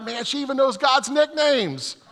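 awed thought. Retold or imitated. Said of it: "And I'm thinking, like".

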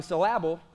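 "syllable" with the stress wrongly on the middle, syl-LA-ble. Shown as a demonstration. Preacher showing the congregation how silly "syllable" sounds, stressed on its second syllable. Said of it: "I just put emphasis on the wrong part of the word".

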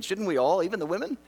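ordinary delivery

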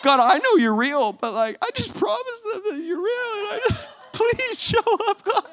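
very high, whimpering mock desperation; scared pleading prayer. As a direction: beg God in a squeaky near-cry. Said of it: "scared [name] mode".

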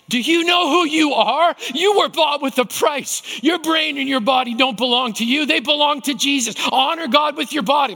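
high, loud preacher's rebuke; booming challenge from the conscience; forceful shout. Retold or imitated. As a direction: bellow it like a mentor calling you out. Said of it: "would show up in my heart and go".

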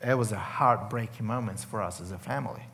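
quiet, low voice close to tears; choked up. Real, not acted. Man recalling his brother's cancer, choked up. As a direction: hold back real tears, softly. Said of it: "I promised I'm not gonna cry".

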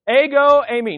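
Ego punched loud and high, eimi dropping back; emphatic Greek phrase. Shown as a demonstration. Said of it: "Let's all say that together".

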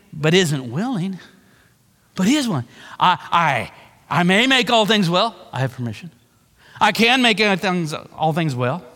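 normal talking voice

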